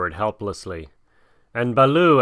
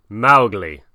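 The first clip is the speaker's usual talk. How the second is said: angry; 5 dB louder than usual. Acted. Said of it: "said Bagheera angrily".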